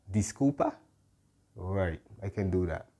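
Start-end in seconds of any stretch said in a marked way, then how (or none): 0.1-0.7 asking. Shown as a demonstration. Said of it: "then I can ask".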